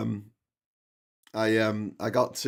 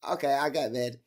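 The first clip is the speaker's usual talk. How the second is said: Roland impression; 2 semitones higher, 3 dB louder than usual. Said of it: "my impression of Roland".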